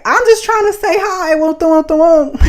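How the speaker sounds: the caught catcaller backing down; flustered guy. Retold or imitated. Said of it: "he was just like".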